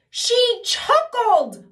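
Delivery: very high, loud, very slow wail; wailing king. Acted. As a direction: stretch it out in a long wail. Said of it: "wailed the king".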